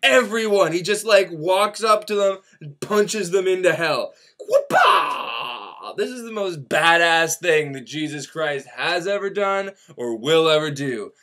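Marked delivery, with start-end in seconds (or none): 4.5-5.9 punch yell. Retold or imitated. Jesus punching enemies into hell.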